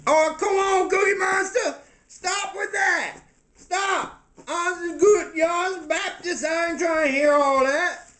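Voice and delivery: high-pitched, agitated objection; exasperated protesting character. Acted. Baptist castmate telling Cookie Monster to stop, exasperated.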